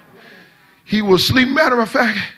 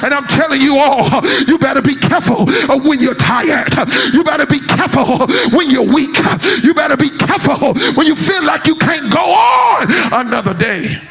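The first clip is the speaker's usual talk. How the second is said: rising, shouted preacher cadence; preaching at full force; 5 semitones higher than usual. Acted. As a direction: preach it hard, building to the peak. Preaching about tiredness.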